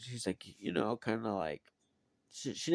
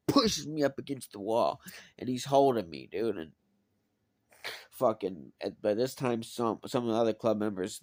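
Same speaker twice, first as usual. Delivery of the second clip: ordinary delivery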